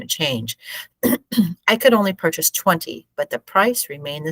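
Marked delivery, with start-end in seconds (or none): none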